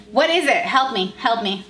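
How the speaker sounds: ordinary talk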